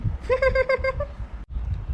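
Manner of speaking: squeaky high-pitched snicker; high giggle. Acted. Husband laughs at calling wife salty, high giggle. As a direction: giggle in a very high voice at your own joke.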